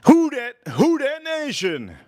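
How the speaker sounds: high, drawn-out rallying call. Real, not acted. Streamer hails a super chat supporter, calling out. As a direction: call it out high, stretching the last words. Calls out a chatter's name.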